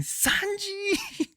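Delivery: thrilled, drawn-out reenacted yell; excited yell. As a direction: cry the name out high in mock-ecstatic hype. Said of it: "up in the air yelling".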